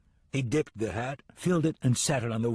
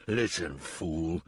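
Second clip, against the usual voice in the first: low growl; 5 semitones lower, much slower than usual. A narrator's reading. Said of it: "he growled".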